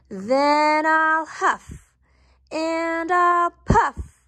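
slow, building storybook bellow; shouting wolf. A narrator's reading. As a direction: stretch each word out like a big storybook wolf. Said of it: "shouted the wolf".

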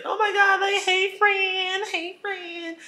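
put-on very high, breathless girly hello; gushing greeting. Friend greeting narrator at bar, high gushing hello. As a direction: gush the hello like a giddy best friend.

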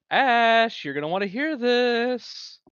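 high, loud call across the room; excited call. Acted. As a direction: call a friend over eagerly. Calling a friend over.

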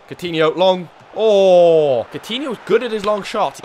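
alarmed excitement. Real, not acted. Manager watching a long shot, alarmed and loud. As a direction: react as the shot flies in.

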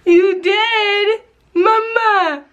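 high-pitched delighted disbelief; delighted amazement. Real, not acted. Mother thrilled baby said mama, amazed. Mother reliving baby's first word.

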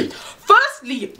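sharp, high, squaring up; confrontational. Pretend. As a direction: call her out to her face. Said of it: "So that's what I would have said".

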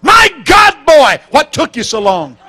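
high, loud mock-scolding cry; mother's exclamation. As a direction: shout it like a mother scolding her son. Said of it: "Guess what she said?".

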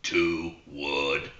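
a low, slow little frog calling out the club; talking frog. Acted. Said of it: "The frog looks up and he goes".

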